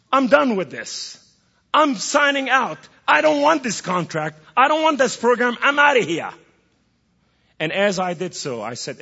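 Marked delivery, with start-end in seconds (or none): 0.1-6.3 raised, high, loud declaration; defiant challenge. Acted. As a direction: throw it down as an angry resignation. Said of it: "if I challenge Allah and tell him".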